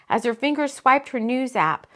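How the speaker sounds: ordinary delivery, nothing marked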